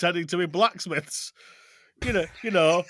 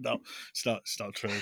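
quiet; 9 semitones lower, 13 dB quieter, faster than usual. Acted. Quiet mock denial.

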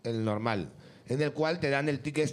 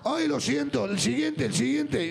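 higher, exaggerated apology; mock-apologetic tourist; 7 semitones higher, slower than usual. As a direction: play the hapless foreigner pleading, pitched up. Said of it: "y con esta voz".

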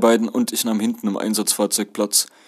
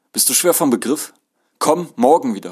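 raised, higher annoyed voice; getting louder; 5 semitones higher, 4 dB louder than usual. A narrator's reading. Conductor repeats her order to the passenger, getting louder. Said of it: "Sie wurde lauter".

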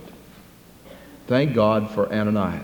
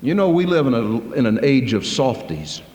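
usual speaking voice

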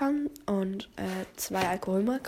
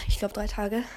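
ordinary talk, nothing marked

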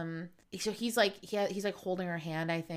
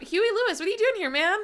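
higher, louder delighted call; excited greeting; 12 semitones higher, 7 dB louder, faster than usual. Pretend. Greeting [name] on the show, excited and loud. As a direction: hail him like an old buddy.

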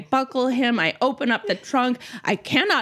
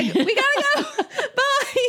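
frantic farewell. Retold or imitated. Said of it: "Like, I".

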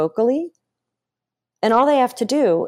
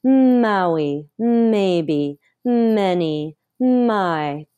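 each word launched from a long hummed m; resonant voice exercise; much slower than usual. Shown as a demonstration. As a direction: stretch the m, glide down through each word, pause between them. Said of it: "Let's carry it into speech".

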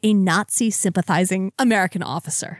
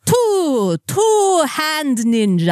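high, slow, laughing vampire count; 9 semitones higher, much slower than usual. Pretend. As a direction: count gleefully like a vampire puppet. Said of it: "it inevitably turns into the Count".

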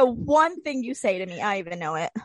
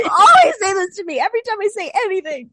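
high, loud aggrieved outburst; loud protest; 7 semitones higher, 7 dB louder than usual. Real, not acted. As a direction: complain loudly at your friend. Loud teasing complaint to friend.

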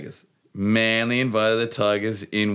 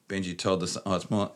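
ordinary delivery

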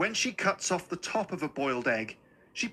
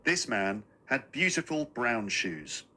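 ordinary delivery

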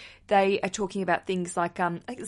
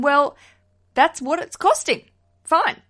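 resigned shrug; 7 semitones higher, 7 dB louder than usual. Retold or imitated. Resigned about budget.

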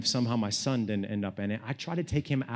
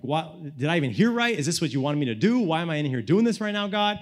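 raised, insistent questions to God; frustrated questioning; 4 semitones higher, 5 dB louder than usual. Retold or imitated. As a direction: question God in loud, rising exasperation.

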